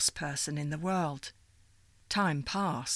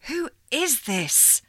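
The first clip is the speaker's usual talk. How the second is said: higher, slow, insistent demand; 9 semitones higher, 4 dB louder, slower than usual. Acted. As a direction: press her for an answer. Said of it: "Sylvie demanded".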